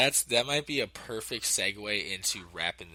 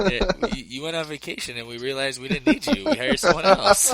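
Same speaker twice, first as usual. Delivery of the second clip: laughing mock booker; 5 semitones higher, 9 dB louder than usual. Playing club booker, laughing.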